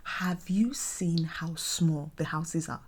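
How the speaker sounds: slow whispered aside. Real, not acted. To viewers about tiny London houses, whispering. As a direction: let them in on a secret.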